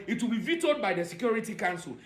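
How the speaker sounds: usual speaking voice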